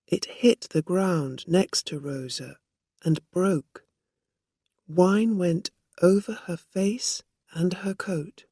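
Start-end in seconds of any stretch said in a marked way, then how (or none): none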